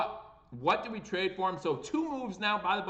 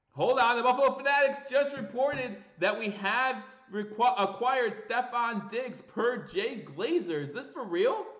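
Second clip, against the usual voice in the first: breaking-news excitement, much higher and louder; can't contain the thrill, pitch shooting up; excited, voice jumping up; 7 semitones higher, 4 dB louder than usual. Real, not acted. Host reacting live to the Diggs trade news, thrilled and high-pitched. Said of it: "my voice goes up about ten notches".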